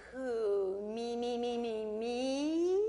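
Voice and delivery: drawn-out, sing-song "me, me, me"; feigned innocence. Acted. Snake asked if he bites, exaggerated innocent protest. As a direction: stretch and wobble it, all innocence.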